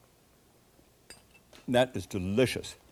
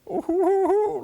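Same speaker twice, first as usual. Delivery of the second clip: very high, loud, drawn-out whoop; mock astonished "ooh"; 6 dB louder than usual. Air hostess seeing what was left of the cake, mock high ooh. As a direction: imitate a hostess's big swooping ooh. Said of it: "like this, you know".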